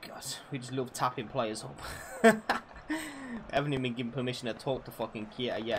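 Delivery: ordinary talk, nothing marked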